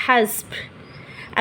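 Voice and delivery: हस्ब whispered slowly, ending on a stopped ब; soft, slow whispered हस्ब with a closed ending; correct stop pronunciation. Shown as a demonstration. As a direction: breathe हस्ब out softly and slowly, no final vowel. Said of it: "इसे हम क्या पढ़ेंगे".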